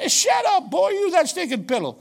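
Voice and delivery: sudden high, loud "shut up" trailing into a gruff scold; mock shout. Preacher retelling how he yelled at a picky homeless guy, mock shout. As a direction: bark it like a playful scolding.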